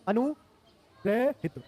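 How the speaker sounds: अनुग्रहित split after "anu", syllables stretched; slow sounding-out. Shown as a demonstration. Teacher writes the word for students, sounding out slowly.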